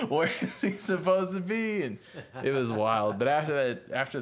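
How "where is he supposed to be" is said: laughing confused bandmate. Retold or imitated. Bandmates lost, missing singer.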